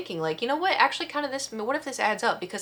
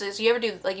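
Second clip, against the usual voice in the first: normal talking voice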